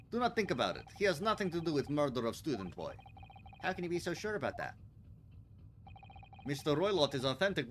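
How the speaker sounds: put-on Russian accent. Sailor dismissing the detectives' question, Russian accent. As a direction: brush them off in a heavy Russian accent. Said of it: "Russian sailor character".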